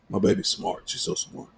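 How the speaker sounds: soft whisper, proud dad; whispered pride. Real, not acted. Proud whisper about daughter.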